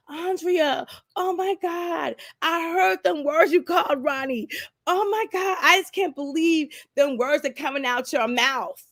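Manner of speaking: high, stunned mother-in-law; a mother horrified by the recording; shocked mother-in-law. Retold or imitated. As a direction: gasp in disbelief at what you heard. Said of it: "his mom was like".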